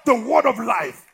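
loud, high preaching cry; full-force preaching. Acted. Preacher roaring at his congregation. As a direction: preach it out over the crowd.

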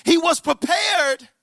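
ringing preacher's cry; emphatic preaching. Real, not acted. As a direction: proclaim it, stretching every word. Preacher drives the climax home.

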